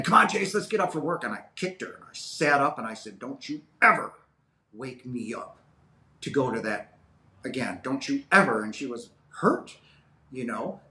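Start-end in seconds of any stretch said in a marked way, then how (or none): 0.0-1.2 higher, louder cheerful wake-up call; grandmother waking him. Retold or imitated. Grandmother rousing the boy for church, bright call. 3.2-8.6 angry refusal. Retold or imitated. Seven-year-old lashing out at grandmother, angry warning.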